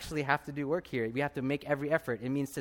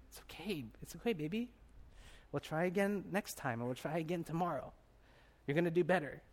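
soft, soothing mother's voice, imitated; gentle reassurance; 3 semitones higher, 7 dB quieter than usual. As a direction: comfort a little one tenderly. Mother reassures child after accident.

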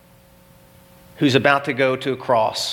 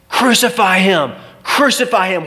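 raised, higher mob chant of the crowd; crowd shouting; 6 semitones higher, 3 dB louder than usual. Retold or imitated. Preacher voicing the crowd outside Barabbas's cell, shouting. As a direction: bellow it like an angry mob outside. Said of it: "hearing the crowds shout".

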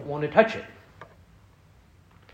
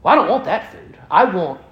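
high, loud mock child's protest; petulant child, slowing on the demand; demanding small child; 4 semitones higher, 4 dB louder than usual. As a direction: imitate a picky kid refusing dinner. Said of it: "those of us who have small children".

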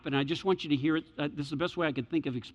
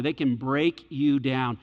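usual speaking voice

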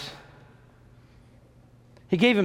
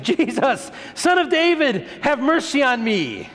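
high-pitched, insistent plea; raised, high pleading cry; 7 semitones higher than usual. Acted. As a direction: call out over the people shushing you. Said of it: "shouted all the louder".

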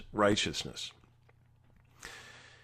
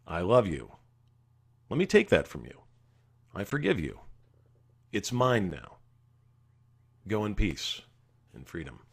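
low, gentle, with long pauses; tender reassurance; 5 semitones lower, 4 dB quieter than usual. Acted. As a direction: lift the burden from them softly. Jesus voiced, comforting burdened sinner.

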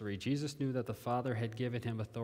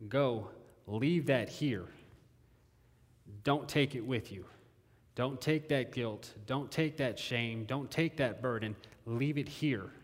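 slow pleading; 3 dB louder, slower than usual. Real, not acted. Pleading with the congregation.

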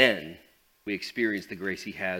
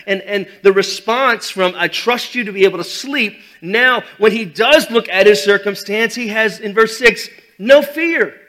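fiery preaching; 9 semitones higher, 15 dB louder than usual. Acted. Preaching at full force.

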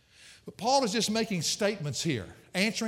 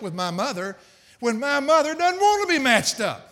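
louder, higher, building preacher's appeal; rising preaching fervor; 6 semitones higher, 6 dB louder than usual. Real, not acted. Preacher pleading to widows in church, loud fervor. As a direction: press the point home to the widows.

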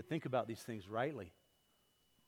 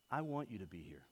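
softer, earnest "I want you to be here", stress on I; mean every word of it, gently; sincere wanting; 6 semitones lower, 8 dB quieter than usual. Shown as a demonstration. Preacher shows the heartfelt invitation to church. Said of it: "Do you hear the difference?".

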